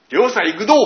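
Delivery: loud, high rustic fisherman's call; Tohoku dialect. Retold or imitated. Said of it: "面白い言い方で". (東北弁で威勢よく叫ぶ)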